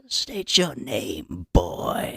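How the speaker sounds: low, very slow, hushed command; menacing elder. Acted. Elder interrogating the newcomer, low and slow. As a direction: slowly demand his name like a dark judge. Acted elder demanding name.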